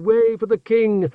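high, slow call to clear the way. Acted. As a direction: herald shouting to clear the street.

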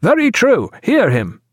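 high-pitched cry that drops at the end; hearty call of assent; cried agreement. Acted. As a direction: back the speaker up eagerly, high. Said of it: "cried Pullings and Granger".

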